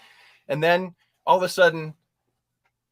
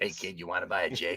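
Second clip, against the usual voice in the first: lower, softer shady dealer voice; seventies druggie; 8 semitones lower, 9 dB quieter than usual. Retold or imitated. Showing the colon voice as a dealer offering a joint, lower and softer. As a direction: murmur it like a seventies street dealer. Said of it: "that voice is like a druggie from the seventies".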